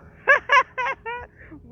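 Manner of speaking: sudden high burst of laughter; loud laugh. Real, not acted. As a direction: crack up loudly at your own joke. Laughs at own warning.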